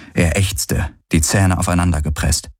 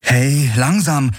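higher, drawn-out call of caution; 6 semitones higher, slower than usual. A narrator's reading. Woman calls to injured man getting up, cautioning call. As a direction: call out slowly to hold him back. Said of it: "rief sie".

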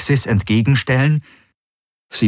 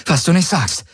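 higher-pitched, strained comeback; tense retort; 10 semitones higher than usual. A narrator's reading. Crewmate answering the pilot under fire, tense. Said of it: "gab Kirai angespannt zurück".

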